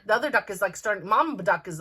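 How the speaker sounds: normal talking voice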